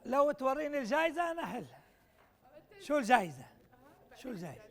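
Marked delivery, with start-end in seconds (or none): 4.2-4.6 softer, lower, repeated to himself; quiet echo. Real, not acted. Lecturer echoing the prize question quietly to himself. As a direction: mutter it again under the breath.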